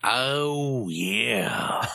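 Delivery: raspy wrestler growl; drawn-out wrestler promo; Macho Man voice. Pretend. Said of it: "talking like a pirate Macho Man".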